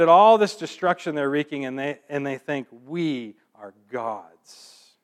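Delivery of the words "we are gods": "We are gods." drawn out slow and much softer; slow, hushed mock boast. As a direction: let the arrogant claim hang, quietly. Voicing the arrogant Chaldeans.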